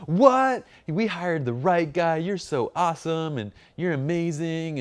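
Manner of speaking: high, loud amazed shout then gushing praise; thrilled client praising the photographer. Retold or imitated. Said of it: "And then they're like".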